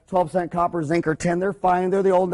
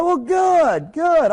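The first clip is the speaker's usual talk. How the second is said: mock cheerful; 9 semitones higher, 4 dB louder, much slower than usual. Dentist joking about broken filling.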